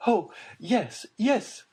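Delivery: eager cry. A narrator's reading. Mother seizing on new hope, crying out. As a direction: grab at the hope. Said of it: "cried the Baroness".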